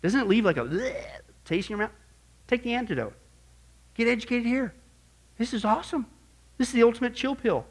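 ordinary delivery

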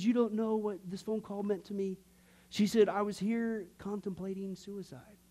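understated, solemn retold confession; composed after sobbing. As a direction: imitate her confiding after tears. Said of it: "she finally composed herself".

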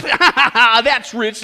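scoffing laugh through the words; mocking laugh. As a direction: laugh it off, loud and sneering. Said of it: "laughed the hairdresser".

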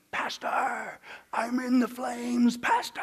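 slow, strained cry in a changed voice; dying man's plea. Retold or imitated. Dying oil rigger to his pastor, strained terrified plea. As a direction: plead with the pastor in terror. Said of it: "clawing at the sheets, going".

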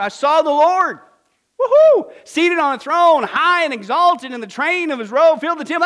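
loud, high, ringing scripture reading; exultant proclamation. Acted. Isaiah's vision of the throne, exultant proclamation. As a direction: proclaim it at full preaching force.

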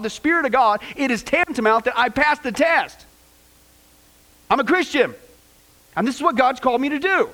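emphatic, high-pitched self-defense; preacher's impassioned voicing of an embattled apostle; Paul. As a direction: declare it forcefully, defying the doubters. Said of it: "Paul is defending his apostleship".

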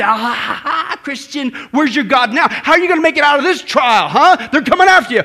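gloating villain's laugh into a loud taunt; mocking enemy laugh. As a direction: laugh at them, then jeer loudly. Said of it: "when the enemy laughs at you".

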